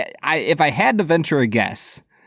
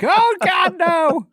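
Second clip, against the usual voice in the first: put-on very high-pitched terrified little kid; mock child's panic; much slower than usual. Co-host voicing host's scared inner seven-year-old, mock panic. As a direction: squeal in exaggerated terror. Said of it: "Little seven-year-old Bob is still inside there".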